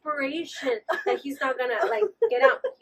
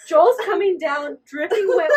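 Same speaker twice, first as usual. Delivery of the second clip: ordinary talk, nothing marked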